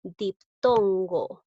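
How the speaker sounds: diptongo drawn out slowly and firmly; slow, stressed word. Shown as a demonstration. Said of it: "se produce".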